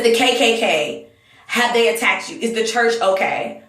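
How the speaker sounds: normal talking voice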